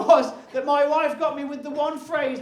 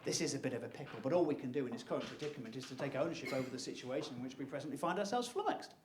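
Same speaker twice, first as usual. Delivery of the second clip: calm wife; 9 semitones lower, 14 dB quieter, faster than usual. Retold or imitated. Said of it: "I'm paraphrasing".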